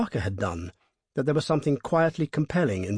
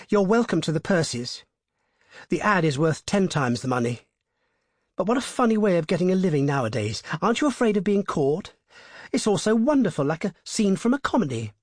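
put-on higher-pitched, gushing young woman; bubbly actress chatter; delighted; 7 semitones higher than usual. A narrator's reading. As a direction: marvel at it all, thrilled. Delighted actresses after tears turn to smiles.

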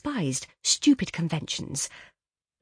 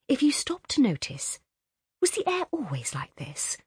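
whispering; 6 semitones higher than usual. Acted. Read in a whisper.

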